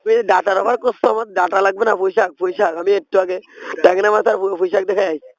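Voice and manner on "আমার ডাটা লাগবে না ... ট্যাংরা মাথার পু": usual speaking voice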